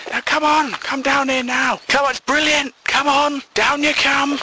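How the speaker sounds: high-pitched coaxing shout from downstairs; insistent calling. Retold or imitated. Mum calls you down to see something funny, high insistent call.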